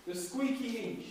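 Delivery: slow and deliberate, each word spaced out; teacher calling out a line for the class to echo; slow call for repetition. Shown as a demonstration. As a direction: lead the class to repeat it back. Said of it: "Repeat after me".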